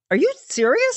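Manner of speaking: a squeaky "are you serious?"; disbelieving. Retold or imitated. Host retelling her stunned reaction, high disbelief. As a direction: pitch jumps way up in disbelief.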